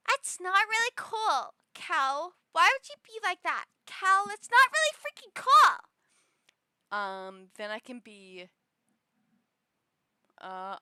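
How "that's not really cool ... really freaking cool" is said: high, loud scolding, building up; Audrey voice. Pretend. Audrey scolding Cal, high and increasingly loud. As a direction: scold Cal, getting louder. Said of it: "Audrey, which was".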